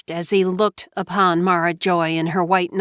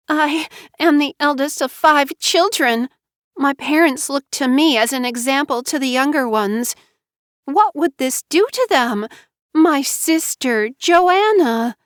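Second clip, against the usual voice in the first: self-pitying Mara Joy; 7 semitones higher than usual. Acted. Wife explaining her pregnancy, high teary plea. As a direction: act wounded and scared.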